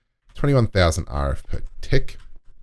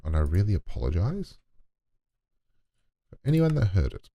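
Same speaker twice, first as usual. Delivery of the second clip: usual speaking voice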